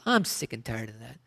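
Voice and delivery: mock tired Southern sigh; Southern complaint. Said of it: "phrase of the South".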